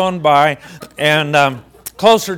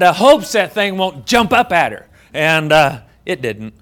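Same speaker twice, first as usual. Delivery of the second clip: ordinary talk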